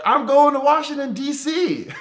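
high-pitched mock reply; imitating himself. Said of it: "I'm like".

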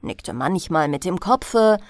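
usual speaking voice

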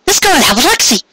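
high, teasing mare voice, coy and flirty; high coy filly voice; playful mare voice. A narrator's reading. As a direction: bat your eyes through the words, very high. Cupcake's voiced lines.

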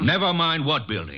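sharp cutoff. Acted. Caffrey cuts Wolfe off mid-sentence, sharp and tense.

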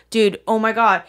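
ordinary talk, nothing marked

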